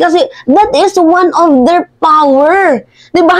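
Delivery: sarcastic high mimicry of a politician; mocking echo. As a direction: parrot him back with a sneer. Throwing Roque's words back.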